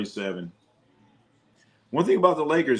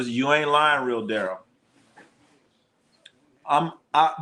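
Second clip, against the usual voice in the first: usual speaking voice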